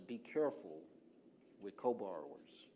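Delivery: slow, measured warning with a pause midway; slow down so the caution sinks in; deliberately slow. Real, not acted. Presenter cautioning the class about co-borrowers, deliberately slow. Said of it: "I'm slowing down on purpose".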